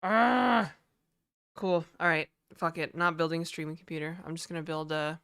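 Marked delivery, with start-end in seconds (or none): 0.0-0.7 loud, higher frustrated shout of Ah; frustrated yell. Real, not acted. Streamer fed up with iCloud, frustrated yell.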